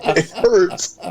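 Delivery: strained laugh, it hurts; wincing between laughs; laughing through pain. Real, not acted. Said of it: "Tim's trying not to laugh".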